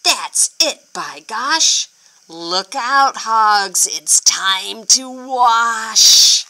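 loud, building to a drawn-out shout; yelled. Acted. Farmer yelling at the hogs that it is wash time. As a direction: announce wash time as a triumphant yell. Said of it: "Farmer yelled".

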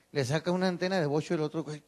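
usual speaking voice

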